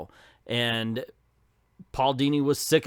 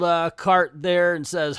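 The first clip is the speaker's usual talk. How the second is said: ordinary talk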